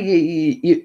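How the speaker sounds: usual speaking voice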